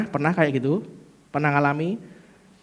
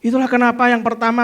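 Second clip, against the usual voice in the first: usual speaking voice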